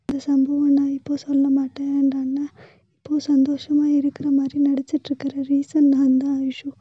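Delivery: loud, pleading, near tears; loud emotional outburst. Acted. Older sister loudly pleads with Aishu about brother's pain, near tears. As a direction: pour it out loud to your little sister.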